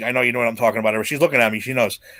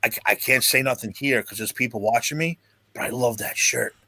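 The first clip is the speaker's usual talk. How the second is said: hushed; 4 semitones lower, 6 dB quieter than usual. Retold or imitated. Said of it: "He goes".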